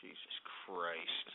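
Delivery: breathy whisper, drawn out low; fed-up whisper to himself; exasperated whisper. Real, not acted. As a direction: sigh it out under your breath. Exasperated after troll names.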